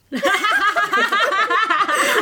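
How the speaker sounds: high squealing laugh. Real, not acted. Flirting scene reaction.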